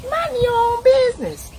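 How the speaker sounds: high, slow put-on voice minding its own business; mock singsong radio voice. Preacher mimicking the radio telling you to mind your business, high and slow. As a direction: sing it out like an innocent radio host.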